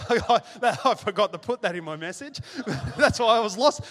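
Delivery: real laughter while teasing the congregation about his wife; laughing. Real, not acted. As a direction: chuckle at his own slip.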